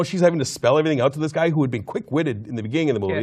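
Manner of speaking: ordinary talk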